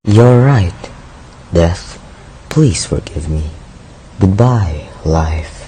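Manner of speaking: low, fading goodbye; quiet, defeated apology; resigned farewell. Acted. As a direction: give up softly, voice sinking away. Youth resigned, says farewell before jumping.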